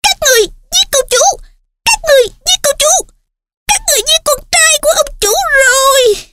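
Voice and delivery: high wailing house-elf accusation. Acted. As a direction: shriek it in frantic grief. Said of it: "ôm lấy ngực hắn và la thét".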